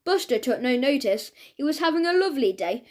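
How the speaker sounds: ordinary delivery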